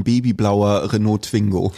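normal talking voice

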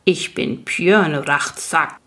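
grind the words out slowly like rock; creaky rock-biter voice. Acted. Rock biter greets the newcomer by the fire, slow creaky rumble.

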